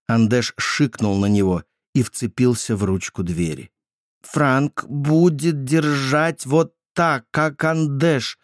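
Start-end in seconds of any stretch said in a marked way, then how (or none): 4.2-8.4 high, slow, odd brother voice; lightly voiced strange child; Andesh's voice. A narrator's reading. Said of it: "быстро проговорил он".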